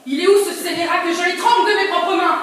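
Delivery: loud raging threat; furious shout. Acted. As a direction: rage at the thief. Furious at thief. (crie de rage)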